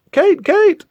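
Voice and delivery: high, urgent mock call. Calling after Kate as she runs, urgent. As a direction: call out a name in hammed-up alarm.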